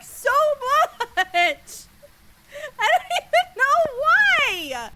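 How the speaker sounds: high, loud shrieking through laughter; real hysterical screech, words stretched out; laughing shriek. Real, not acted. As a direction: shriek it helplessly between laughs. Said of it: "Sorry for shrieking".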